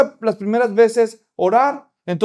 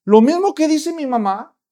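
put-on much higher child voice protesting; little girl; 7 semitones higher, 4 dB louder than usual. Little girl tells the pastor she can't pray, high child voice. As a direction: squeak out a scared refusal.

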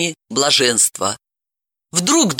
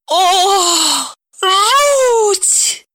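high, loud wail of dismay; helpless groan; 4 dB louder than usual. A narrator's reading. Dalila clutching her temples, moaning in horror. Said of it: "она застонала".

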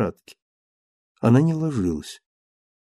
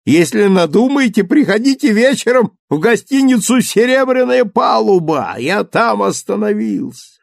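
charlatan dentist; 8 semitones higher, 3 dB louder than usual. Acted. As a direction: shout it after her like a quack. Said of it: "как шарлатан кричал ей". (кричащий вслед шарлатан)

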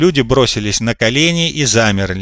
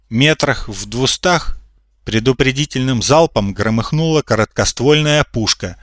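ordinary delivery, nothing marked

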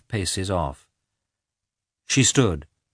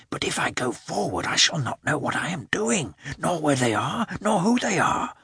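worried inner voice; 8 semitones higher, 3 dB quieter than usual. A narrator's reading. Dog's worried thoughts.

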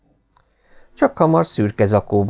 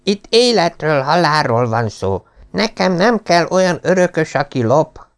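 raised, lightly voiced stern father; stern old lord; 8 semitones higher than usual. A narrator's reading. Lord Travers lecturing his thieving son, higher stern voice. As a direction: lay down the law to the heir.

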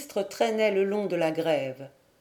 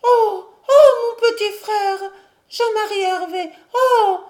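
high, loud wail on long held vowels; howling cry; 12 semitones higher, 9 dB louder than usual. A narrator's reading. Mad sister recognising her little brother, a high howl. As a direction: howl it out like a madwoman. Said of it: "Elle poussa une sorte de hurlement".

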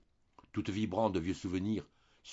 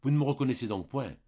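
pleading stammer; 5 semitones higher, 5 dB louder than usual. A narrator's reading. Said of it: "et balbutiant".

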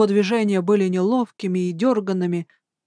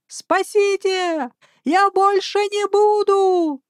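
high, slow panicked skeleton wail; desperate wail; 12 semitones higher, 3 dB louder, slower than usual. Acted. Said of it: "отчаянно вопил скелет".

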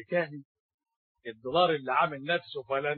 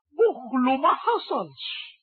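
incredulous; 9 semitones higher, 5 dB louder, slower than usual. Acted. Exaggerated claim about miser.

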